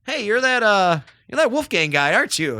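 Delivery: high, loud eager realization; blurting out the celebrity's name, thrilled; excited recognition. Retold or imitated.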